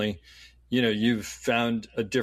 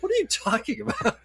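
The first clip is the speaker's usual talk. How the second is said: much higher, laughing at himself; laughing self-scold; 10 semitones higher than usual. Retold or imitated. Catching his own snobbery, high laughing self-rebuke. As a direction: scold yourself while laughing. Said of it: "I caught myself and I'm like".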